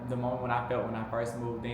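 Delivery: ordinary delivery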